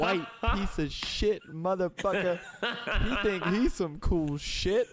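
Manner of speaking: high, slow, laughing mock rant of a ranting stranger; angry street guy. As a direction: ham up the furious stranger yelling at the wall. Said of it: "just the dramatic performance of what this guy was doing".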